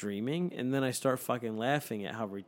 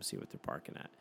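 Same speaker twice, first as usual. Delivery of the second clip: tired mumble; 9 semitones lower, 9 dB quieter, faster than usual. Retold or imitated. Tired reply to wife.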